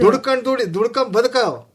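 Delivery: high-pitched imitation of an elder's rebuke; mock scolding, urgent but not shouted; retold scolding. Grandfather ordering sons to work and live, retold scolding. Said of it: "ಅನ್ಕೊಂಡ್ ಬೈತಿದ್ನಂತೆ".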